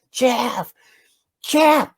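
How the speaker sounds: high-pitched call, held back in volume; restrained mock yell. Boy calling his friend back with neighbors everywhere, restrained high yell. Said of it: "the whole time I'm going".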